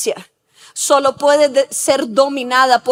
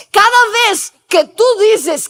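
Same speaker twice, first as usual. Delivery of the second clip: normal talking voice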